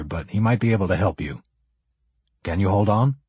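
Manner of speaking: lightly voiced cop talking to a survivor; understated officer's voice, practical and measured; cop character, matter-of-fact. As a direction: explain the offer plainly, in character. Kneeling cop talks to survivor.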